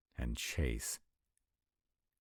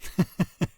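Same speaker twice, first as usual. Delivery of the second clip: short, high burst of giggles; quick high chuckle; amused laugh; 10 dB louder than usual. Acted. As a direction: laugh out loud briefly. Amused at the Uber explanation.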